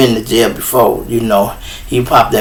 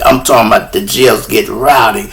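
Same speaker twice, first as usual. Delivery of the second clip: usual speaking voice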